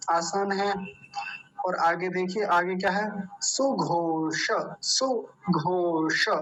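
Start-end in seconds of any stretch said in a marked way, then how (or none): 3.4-6.3 सुघोष drawn out with a retroflex ष; slow, careful. Shown as a demonstration. Teacher shows the retroflex sound to students, slowly. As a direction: stretch सुघोष slowly, stressing the ष. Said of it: "मूर्धन्य शकार का उच्चारण विशेष ध्यान".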